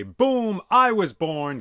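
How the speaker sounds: sudden loud high boom; excited boom. A narrator's reading. Narrator booming out his own birth. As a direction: burst it out like a thunderclap.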